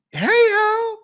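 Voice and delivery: put-on squealed very high, like a girl spotting her friend; girls greeting each other. Imitating girls greeting each other in a very high squeal. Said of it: "A lot of girls talk like this".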